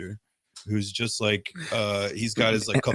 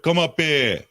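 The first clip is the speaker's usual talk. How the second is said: mock call, higher and louder; 6 semitones higher, 5 dB louder, slower than usual. Retelling bassist summoning a young punk, calling out. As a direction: call a kid over, retold.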